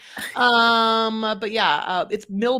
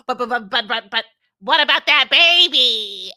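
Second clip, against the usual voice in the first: louder stammering put-on protest; Gino protesting; 8 dB louder than usual. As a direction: stammer out the objection. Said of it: "Gino's like".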